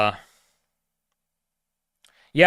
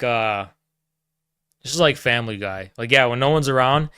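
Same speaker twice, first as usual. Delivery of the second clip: ordinary talk, nothing marked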